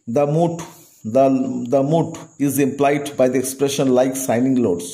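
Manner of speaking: slow, deliberate English read-aloud. Shown as a demonstration. Teacher reading the question slowly to the class. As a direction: spell the question out slowly for students. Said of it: "teacher reads question slowly".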